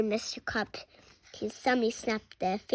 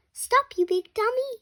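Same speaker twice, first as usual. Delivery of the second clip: high, louder yell; yelling stop; 11 semitones higher, 5 dB louder than usual. Acted. Kid yelling at Captain Underpants, high and loud. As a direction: shout at him to stop.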